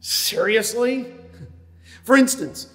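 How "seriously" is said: slow, incredulous sarcastic seriously; drawn-out sarcastic disbelief. Shown as a demonstration. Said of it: "a sarcastic tone in the midst of it".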